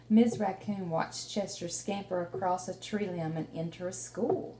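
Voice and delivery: lively read-aloud storytelling; reading to children. Acted. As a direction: tell the story to a small child, warmly paced. Teacher reads picture book aloud.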